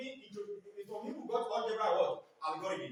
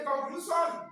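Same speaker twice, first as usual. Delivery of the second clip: put-on Yoruba-accented "conclusion", SH turned to S; 5 semitones higher, slower than usual. Teacher mimicking Yoruba speakers for the class, Yoruba accent. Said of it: "They cannot call S H properly".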